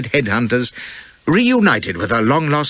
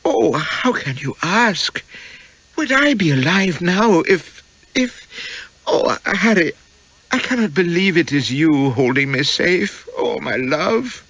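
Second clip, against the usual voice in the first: higher, slow, near tears; tearful moaning; 8 semitones higher, slower than usual. Acted. Rescued wife to husband, slow and tearful. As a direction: let the tears break through each phrase. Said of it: "moaned softly, those innocent eyes brimming with tears".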